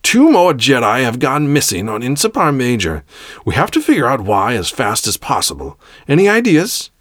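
imperious leader addressing subordinates; stern Grand Master. Acted. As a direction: demand answers from the council.

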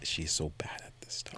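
hushed whisper, breath only. Real, not acted. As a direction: lean in and whisper it. Gossiping about Max nearby.

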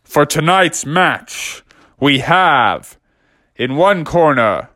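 drawn-out boxing announcer, rising; ring announcer. Acted. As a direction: introduce the fighter with big pauses. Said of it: "the announcer was like".

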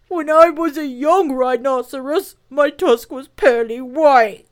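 high, loud plaintive rhino voice; hammed-up weepy creature voice; mournful rhinoceros. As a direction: lament lost youth as an old beast.

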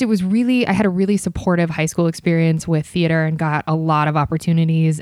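normal talking voice

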